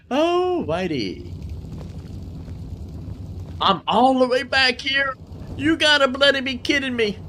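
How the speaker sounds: high, disbelieving cry of frustration; can't believe you're back at the start; exasperated disbelief. Real, not acted. Streamer finds himself sent all the way back, exasperated and high. Respawned far away after dying.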